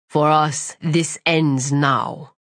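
low, very slow threat; menacing villain. Acted. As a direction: lay it down low and final.

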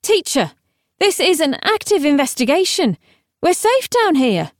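high, firm objection. A narrator's reading. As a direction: raise your voice to object across the room. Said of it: "Camilla raised her voice to say".

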